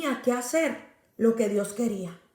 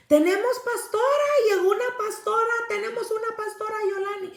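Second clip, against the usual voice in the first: much higher, calling out joyfully across the place; excited shouting; 9 semitones higher than usual. Retold or imitated. Woman shouting to the detainees that a pastor has arrived, excited cry. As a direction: announce the news to everyone, shouting with joy. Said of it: "empieza a gritar".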